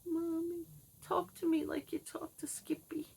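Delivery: put-on high, whispered little-girl plea; a child whispering to her mother; little girl pleading. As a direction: beg softly like a small child. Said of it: "I'd go crawling back".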